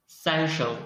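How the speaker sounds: ordinary talk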